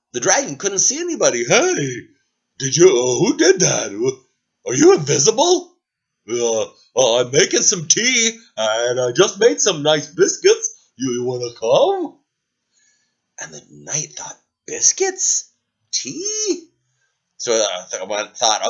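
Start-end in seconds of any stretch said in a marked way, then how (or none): 1.4-12.2 eager, louder dragon inviting; friendly dragon. Acted. Dragon character voice. 14.7-16.7 high incredulous whisper; whispered, much higher surprise; surprised. Acted. Knight surprised by offer.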